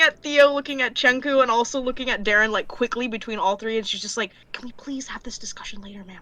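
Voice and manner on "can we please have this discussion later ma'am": hushed, rushed whisper; urgent whisper. Acted. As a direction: whisper fast so the king won't hear.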